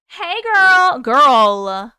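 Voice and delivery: big, loud call-out; hollered greeting. Pretend. Streamer greets a viewer, hollering playfully. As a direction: holler it to a friend.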